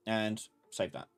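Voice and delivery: ordinary talk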